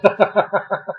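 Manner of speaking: soft, rolling amused laugh; derisive chuckle. Real, not acted. Resident mocking the parking enforcement contractor wording, derisive chuckle.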